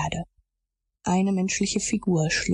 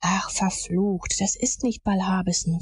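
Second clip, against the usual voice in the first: ordinary delivery, nothing marked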